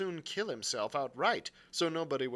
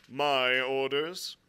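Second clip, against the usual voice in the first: loud, weighty and slow; curt captain; 5 dB louder, much slower than usual. A narrator's reading. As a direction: state it with firm authority. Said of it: "said the captain shortly".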